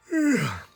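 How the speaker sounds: long weary sigh before boring; heavy sigh. Acted. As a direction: sigh like a bored kid. Narrator groans at boring errands.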